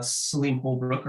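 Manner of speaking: ordinary talk, nothing marked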